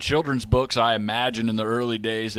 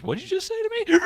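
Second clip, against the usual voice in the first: much higher, mock angry shout; pigeon picking a fight; faster than usual. Stubborn pigeon squaring up to the fish, high mock shout. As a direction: squawk the challenge. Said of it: "And stubborn pigeon goes".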